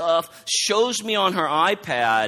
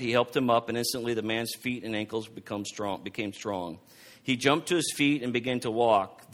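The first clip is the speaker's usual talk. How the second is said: lower, measured Bible reading voice; scripture reading; 4 semitones lower than usual. Acted. Preacher reading Acts three to the congregation, measured reading. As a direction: read the passage steadily to the congregation.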